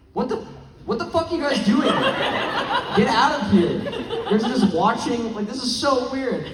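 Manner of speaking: bewildered outsider shouting get out, half laughing; mock shocked outsider. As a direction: play a stranger walking in on something bizarre. Imagined outsider walks in.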